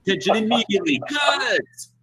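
high, hammed-up demand for attention; calling out. Prisoner demanding attention from the guards, calling out. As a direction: call out like an outraged prisoner.

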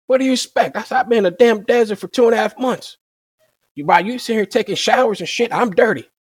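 retelling how he vented his frustration, pitched well up; indignant retort. Retold or imitated.

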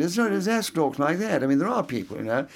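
affected, put-on la-di-da English accent. Shown as a demonstration. Actor showing listeners what a put-on accent is like, la-di-da accent. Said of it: "the la-di-da accent".

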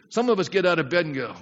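normal talking voice

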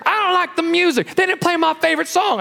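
high petulant gripe; mock whiny complaint. Churchgoer in the pew, high petulant mock gripe. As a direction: pout about the music. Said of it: "And you come to church".

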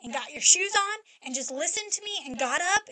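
retold rant, pushing up on each item; retold yelling. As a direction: rattle off the scolding in an imitated yell. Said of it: "Yelled and yelled and yelled".

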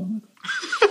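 high, laughing squeak of disbelief. Retold or imitated. Stagiaire realising he cooks beside the head chef for the inspector, laughing squeal.

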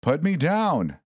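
panicked squeal to be released. A narrator's reading. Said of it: "the mannequin cried".

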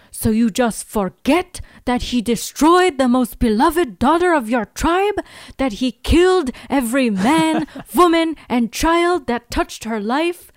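hammed-up Eastern European villain, breaking into a laugh; Russian accent. The villain accuses Jenny of forgetting, a hammed-up Russian accent. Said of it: "hear me try to do an accent".

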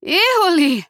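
high sudden shriek; alarmed cry. Acted. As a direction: cry out in shock.

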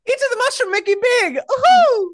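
high, loud cartoon Italian accent; Italian plumber squeal rising to a whoop; Mario. Holy Spirit as video-game hero, whooping Italian accent. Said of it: "Holy Spirit's got an Italian accent".

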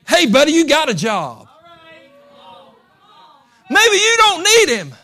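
high, loud, fervent shouting; Bartimaeus preaching back. Acted. Blind beggar defying the crowd, shouting high and loud.

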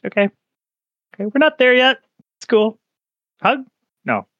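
backing off an offered hug, a bit flustered; nervous high-pitched backpedaling; awkward NPC voice. Acted. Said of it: "He's like".

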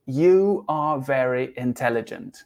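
slow, careful "You are very intelligent"; slow model sentence. Shown as a demonstration. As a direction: say the full sentence slowly for learners. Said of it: "Tengo que utilizar el pronombre". (lento, modelando para los alumnos)

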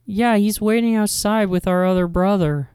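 high put-on little kid voice; young child. Child telling adult about dad waiting outside, childlike. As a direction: answer like a small child. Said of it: "if you're two young children".

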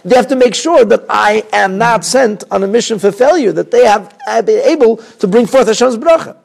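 high, loud preaching at full force; fervent sermon voice, loud and high; fiery preaching. Acted. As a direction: preach it with urgency to the crowd. Impassioned preaching opening.

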